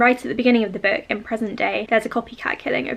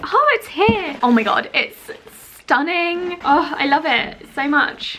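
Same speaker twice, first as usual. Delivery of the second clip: excited gush; 5 semitones higher, slower than usual. Real, not acted. Unboxing long-awaited book.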